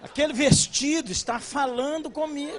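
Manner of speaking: wife to husband in the store, delighted mock gush. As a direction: gush like a wife smitten by a dress. Wife enchanted by a dress.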